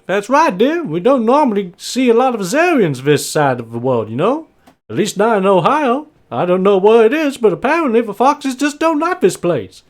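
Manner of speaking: high, loud, chatty farmer; Bob. Acted. Farmer agreeing with his wife before guests, high and hearty. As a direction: chat away high and swooping. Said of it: "and Bob just looks at her and just goes".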